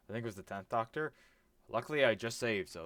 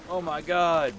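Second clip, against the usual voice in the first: higher and louder oh my god; alarmed cry; 8 semitones higher, 12 dB louder, much slower than usual. Real, not acted. Gamer panics as enemy attacks, alarmed cry. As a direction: blurt it in real alarm.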